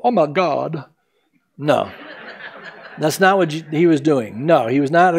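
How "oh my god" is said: put-on airy valley girl gasp; mock valley girl "Oh, my God"; a light valley girl squeal. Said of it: "like a valley girl".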